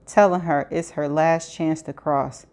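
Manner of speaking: ordinary delivery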